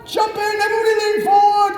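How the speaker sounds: very high, loud and fast order; thrilled RAF chap shouting orders; excited command. Retold or imitated. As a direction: bark the takeoff plan. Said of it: "And Charlie's like".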